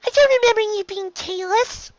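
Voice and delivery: small filly, puzzled and hesitant; curious filly. Acted. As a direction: ask shyly like a young girl. Filly questions.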